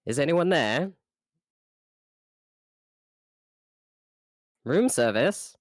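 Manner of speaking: calling out. Acted. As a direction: call out down the corridor. Calling out for a guard.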